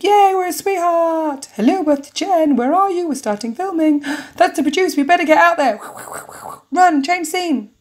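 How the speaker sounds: high, loud, excited squeal. Acted. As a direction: gush like a thrilled contestant. Excited character voice greeting Jen.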